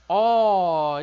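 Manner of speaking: loud, open O called out; quiz answer. Shown as a demonstration. Teacher answering their own quiz question loudly. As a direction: call the vowel out like an answer. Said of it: "A nossa vogal".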